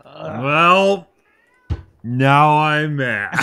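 loud, high, slow mock fury; mock angry. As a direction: drag out the words in hammed-up rage. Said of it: "There it is".

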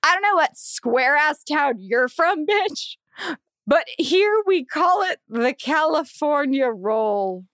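slow, drawn-out mocking snob voice with a shout at the end; entitled driver. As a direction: play the smug rich driver, dragging it out.

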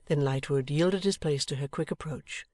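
ordinary delivery

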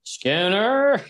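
high, loud cartoon bellow; Superintendent Chalmers. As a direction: shout the name, drawn out, like an outraged superintendent. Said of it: "This fucking superintendent".

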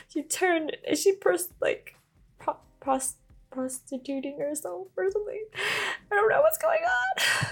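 high-pitched confused rant; incredulous squeak. Real, not acted. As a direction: pitch shooting up in baffled disbelief. Baffled reaction to the plot.

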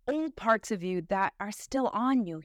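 ordinary talk

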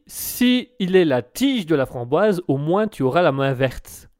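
slow, deliberate reading, each word laid out; careful reread. Shown as a demonstration. Host slowly rereading the pun for his co-host. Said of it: "je vais peut-être te la relire".

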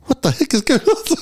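high, laughing disbelief. Retold or imitated. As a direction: laugh through the question. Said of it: "I was like".

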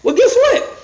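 spring the gotcha; sharp comeback. Real, not acted. Preacher turning on the listener, high excited jab. Punchline after acted quote.

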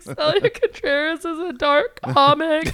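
high, slow announcer-like bio read; mock formal bio. Typing her Skankfest bio on the toilet, mock announcer. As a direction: read your bio like a grand intro.

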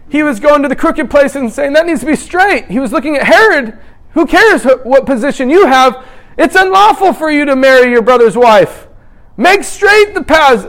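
high, forceful preacher's cry with confronting quotes; impassioned preaching. Acted. Preacher retelling John confronting Herod, loud and fervent. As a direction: drive it hard and high, building in volume.

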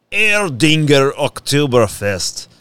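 slow, put-on German accent; mock German brand read. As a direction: say the beer name like a German. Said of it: "I don't know what accent that is".

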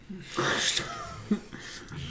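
high breathy laugh. Real, not acted. Laughs after cursing.

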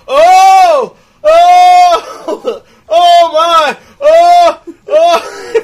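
high, loud mock wails of horror; imitating screaming woman. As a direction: cry out over and over, hammed-up. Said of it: "it tapped into something primal in her".

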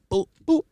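high-pitched comic "boop" noises; little high boops for each thrust; imitated thrusting noise. Imitating thrusts in bawdy story.

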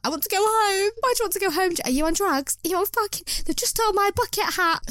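put-on high, whiny toddler having a meltdown; high-pitched mock tantrum, close to tears. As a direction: wail it like a told-off toddler throwing toys. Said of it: "do you know what I'll be like when a toddler, like, gets told off".

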